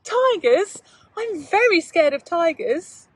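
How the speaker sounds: put-on high, loud frightened child's cry; a small boy alarmed at the tigers; voiced boy, startled and scared; scared little boy. Boy Tom fears the tigers.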